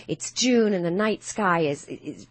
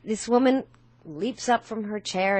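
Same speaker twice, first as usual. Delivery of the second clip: ordinary talk, nothing marked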